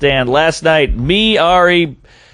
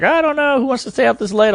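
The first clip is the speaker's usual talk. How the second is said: higher-pitched imitated manager; reluctant manager; 8 semitones higher than usual. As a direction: hem and haw like a doubtful manager.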